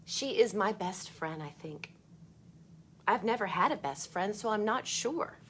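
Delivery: put-on bright read-aloud voice for children; storybook reading. A narrator's reading. Teacher reading a book to kids, lively read-aloud.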